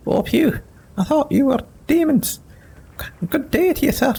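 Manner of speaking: a startled small fellow relieved it's not demons; squeaky-high halfling, flustered and polite; nervous halfling voice. Acted.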